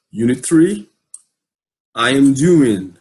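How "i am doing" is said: I am doing spoken very slowly and carefully; slow model English. Shown as a demonstration. Teacher reading the lesson title to students, very slow. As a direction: spell out I am doing slowly for learners. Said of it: "Mais uma vez".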